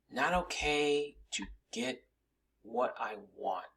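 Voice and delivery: slow, dropping into a whisper; a hushed, slow confession; hushed reveal. Acted. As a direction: let the last words fade to a whisper. Dramatic reveal of last lesson.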